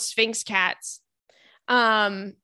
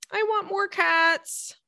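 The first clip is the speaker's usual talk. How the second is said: high, slow, pleading little voice; whiny plea; 9 semitones higher, slower than usual. Pretend. Host begging for more cats, high whiny plea. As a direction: beg for more cats like a child. Repeats wish in put-on voice.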